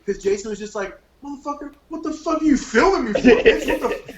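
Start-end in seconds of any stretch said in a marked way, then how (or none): 1.2-4.1 high, loud furious outburst; angry yell. Retold or imitated. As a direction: rage at being filmed. Jason furious about being filmed.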